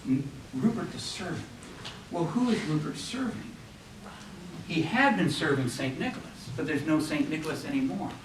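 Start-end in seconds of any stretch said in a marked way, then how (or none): 0.1-3.4 puzzled inner question. Retold or imitated. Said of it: "You ask yourself".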